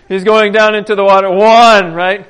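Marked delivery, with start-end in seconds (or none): 1.3-1.9 called-out count, much louder. Retold or imitated. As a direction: announce each dip to the crowd. Counting dips in the river.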